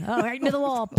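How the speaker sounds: high, loud crash call; playful high-pitched alarm; mock race commentary. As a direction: exclaim like a commentator seeing a wreck.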